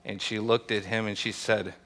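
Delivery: ordinary talk, nothing marked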